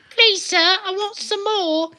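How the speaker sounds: very high, slow pleading orphan; whimpering Victorian urchin; Oliver Twist. Pretend. Cohost quotes Oliver Twist in a high, slow whine. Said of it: "Oliver Twist is the only".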